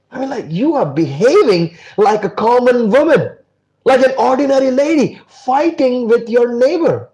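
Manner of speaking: high, loud reproach, slowing at the end; disappointed scolding. Acted. Kabir scolding Janabai for fighting her neighbor, reproachful. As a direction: tell her off like a let-down elder.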